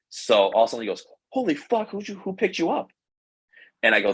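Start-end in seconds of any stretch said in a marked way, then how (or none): 1.3-2.9 very high-pitched stunned exclamation; astonished. Retold or imitated. Locker buddy learning his friend got picked up, astonished. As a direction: imitate a buddy's high, disbelieving outburst.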